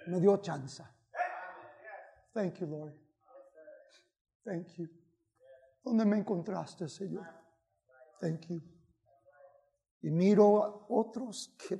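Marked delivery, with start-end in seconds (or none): 2.3-8.6 hushed grateful prayer; quiet prayer. Real, not acted. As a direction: drop to a hushed private thank you. Preacher breaks into quiet prayer.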